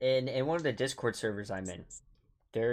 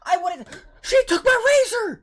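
very high, loud protest; indignant outburst; 9 dB louder than usual. Real, not acted. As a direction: shriek your defence in outrage. Indignantly defends against accusation about sister.

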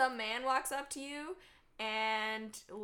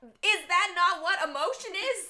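loud, high, mock-innocent question; playing dumb to a critic, loud; 7 semitones higher, 8 dB louder than usual. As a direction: ask it as if truly puzzled, loudly. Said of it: "And I'm like".

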